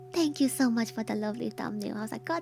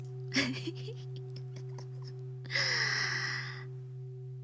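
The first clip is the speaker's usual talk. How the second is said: witch cackle; 13 semitones higher, 6 dB quieter than usual. Acted. Spooky laugh mid-intro.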